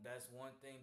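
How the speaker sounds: ordinary talk, nothing marked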